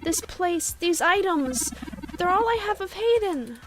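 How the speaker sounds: frantic shock at a wrecked home; high, breaking distress over the ransacked apartment; distraught. Acted. Character grieves missing Hayden.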